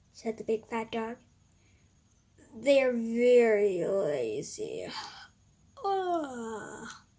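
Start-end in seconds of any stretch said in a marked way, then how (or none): 2.5-7.1 slow, drawn-out lament ending in a cry; the fat dog sadly complaining about his owners; sad dog sigh. Acted.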